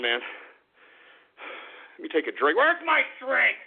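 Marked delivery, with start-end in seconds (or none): 2.6-3.6 high, drawn-out demand; exasperated, raised-voice demand; irritated yell. Real, not acted. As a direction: call out crossly, stretching the words. Irritated host demands his drink.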